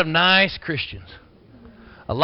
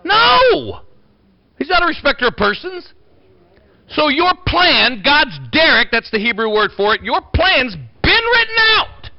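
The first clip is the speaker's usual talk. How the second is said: preaching at full force; 5 semitones higher than usual. Acted. Pastor rousing his church with raised preaching voice. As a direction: fire up the congregation, voice raised.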